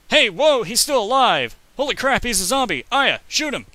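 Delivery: loud, high-pitched urgent exclamations; excited alarm. Real, not acted. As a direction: yell orders at the game as a zombie rises. Reacting to corpse rising in game.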